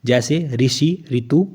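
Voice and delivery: ऋषि stretched out slowly, its ऋ said as री; slow teaching demo of ऋषि and ऋतु; example words. Shown as a demonstration. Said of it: "री का प्रयोग केवल संस्कृत में".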